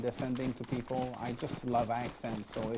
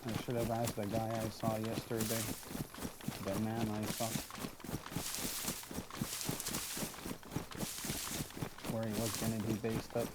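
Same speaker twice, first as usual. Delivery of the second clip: a lone survivor thinking aloud, quiet and lower; in-character survivor; 3 semitones lower, 8 dB quieter than usual. Acted. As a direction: muse to yourself while trekking alone, subdued.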